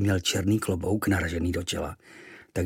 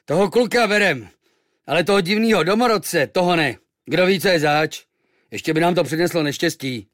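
high, loud suspicious sailor; rough crew turning someone away; gruff sailor; 8 semitones higher, 6 dB louder than usual. Acted. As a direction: sailors loudly refusing the native. Sailors deciding loudly.

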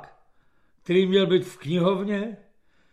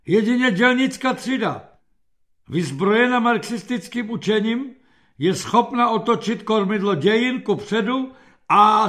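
raised, loud sloganeering; political declamation; 5 semitones higher, 5 dB louder than usual. A narrator's reading. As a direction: declaim it like a party orator. Captain recites political slogans to general. (budovatelská deklamace)

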